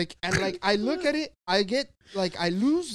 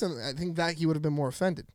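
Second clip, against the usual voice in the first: ordinary delivery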